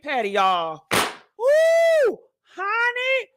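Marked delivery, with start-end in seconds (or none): 1.4-2.2 a high, loud falsetto whoop; excited whoop. Real, not acted. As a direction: let out a big hype woo. Hyped exclamation opening rant.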